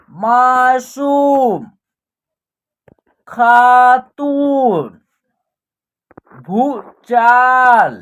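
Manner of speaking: slow, stretched syllables on a level pitch; drawn-out word drill. Shown as a demonstration. Teacher reading out a Hindi word list for practice, drawn out. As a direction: read each word slowly, holding the long vowels.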